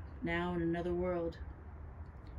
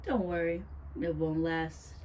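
usual speaking voice

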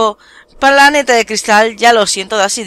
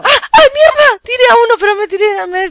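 high, loud panicked scream; screaming while falling; 13 semitones higher, 4 dB louder than usual. Real, not acted. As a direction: shriek as you fall off. Knocked off the map, screaming. (grito agudo al caer)

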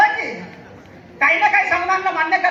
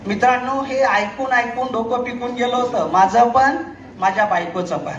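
ordinary delivery

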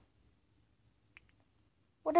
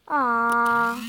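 usual speaking voice

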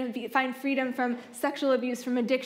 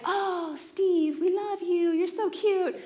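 lightly mocked fangirl squeal, pitched up; swooning fangirls; 6 semitones higher, slower than usual. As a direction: gush over the cute singer. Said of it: "the girls are just like".

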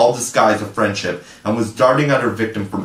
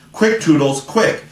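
much higher urgent cry; screamed; 6 semitones higher than usual. Acted. Tinker Bell urges Toodles to shoot, much higher urgent cry. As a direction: hurry him on, voice leaping up. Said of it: "she screamed".

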